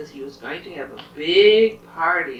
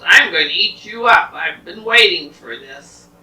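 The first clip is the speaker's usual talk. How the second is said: lightly voiced troll, louder; a storybook threat, punched loud; threatening troll; 10 dB louder than usual. Troll threatens the little goat, mildly menacing and loud.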